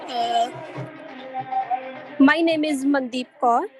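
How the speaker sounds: ordinary delivery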